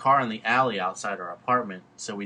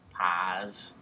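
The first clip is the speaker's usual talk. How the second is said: normal talking voice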